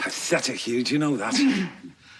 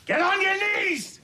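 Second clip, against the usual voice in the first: furious shout. Acted. Tormentor shouting at the kneeling vicar, furious. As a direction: scream at him to beg and pray.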